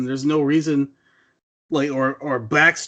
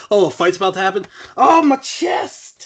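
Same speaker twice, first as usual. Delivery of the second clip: mock fit of chest pain; 5 semitones higher, 5 dB louder than usual. A hybrid fighter's fake seizure before a fight, hammed up. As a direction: ham up a hero clutching her chest. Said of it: "It's like".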